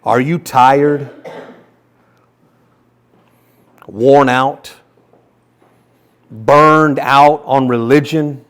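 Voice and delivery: fervent slow Bible reading; scripture reading. Shown as a demonstration. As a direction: build the passage with fervour. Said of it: "read this same passage in the Message version".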